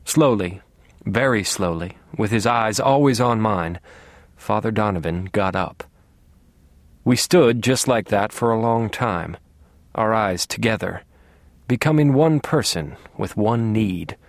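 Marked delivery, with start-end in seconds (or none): none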